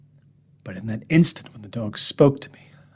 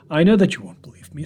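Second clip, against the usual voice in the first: normal talking voice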